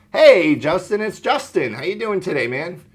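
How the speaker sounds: high, lively greeting that lifts on Hey; upbeat, friendly opener to a prospect; enthusiastic sales greeting. Shown as a demonstration. Agent greeting a lead on a demo call, excited. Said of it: "So enthusiasm, excitement, good tempo".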